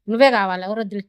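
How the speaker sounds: normal talking voice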